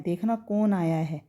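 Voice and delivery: कौन wrongly said as 'kone', closed o; mispronounced o vowel. Retold or imitated. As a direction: imitate people saying 'kone' for कौन. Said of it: "तो ये गलत हो जाएगा". (कौन को गलत 'कोन' बोलें)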